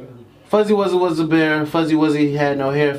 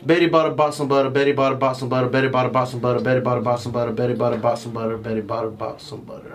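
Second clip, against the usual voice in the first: fast tongue twister; 3 semitones lower, faster than usual. Shown as a demonstration. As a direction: race through it five times. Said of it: "say it five times fast".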